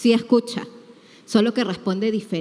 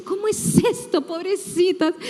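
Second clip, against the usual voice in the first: high, sing-song condescending pity, higher; mock pity; 9 semitones higher than usual. Doubting leader mocking women who still pray, condescending. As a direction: pity them with a smirk.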